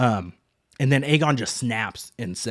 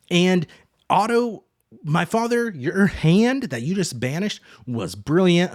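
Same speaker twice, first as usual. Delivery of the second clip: retold indignant pride; 7 semitones higher, 4 dB louder than usual. Mother defends her father.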